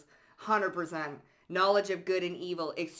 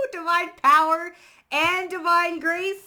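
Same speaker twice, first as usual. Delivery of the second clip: much higher and louder, laughing with joy; laughing excitement; 11 semitones higher, 12 dB louder than usual. Real, not acted. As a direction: proclaim it through delighted laughter. Excited blessing proclamation.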